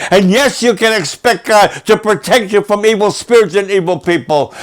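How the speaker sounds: high, loud, driving appeal; full-force preaching. Acted. Preacher urging listeners to give and pledge, loud and high. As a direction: press them hard to pledge now.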